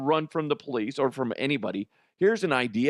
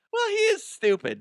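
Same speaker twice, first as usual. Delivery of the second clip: very high falsetto character voice; put-on tiny high voice; young relative. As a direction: squeak it as a little relative. Relative's reply in same voice.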